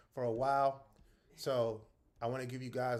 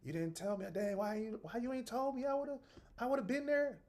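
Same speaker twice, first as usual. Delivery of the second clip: rising higher and higher, a whiny complaint; disappointed friend; 11 semitones higher than usual. Retold or imitated. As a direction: play the friend who missed out, getting more upset with each line. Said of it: "So it wouldn't be a".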